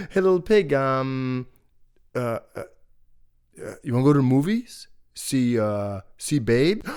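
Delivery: eager hesitant wolf. Acted. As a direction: pitch the invite nervously. Wolf inviting pig.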